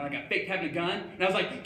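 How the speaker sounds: normal talking voice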